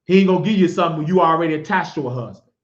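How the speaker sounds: ordinary talk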